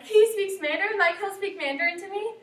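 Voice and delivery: overwhelmed and amazed, voice jumping up; amazed tearful disbelief. Retold or imitated. Mai hears God speaks Mandarin, high amazed tearful voice. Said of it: "Mai bursts into tears and is like".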